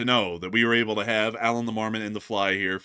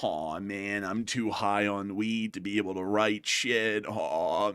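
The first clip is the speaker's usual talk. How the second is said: low, soft droning voice; drawn-out, sluggish aw man; The Fly; 3 semitones lower, 5 dB quieter, slower than usual. Pretend. Host voices The Fly character.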